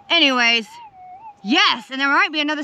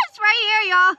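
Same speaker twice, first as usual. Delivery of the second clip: excited call; 6 semitones higher than usual. Real, not acted. Finds the nugget in the dirt.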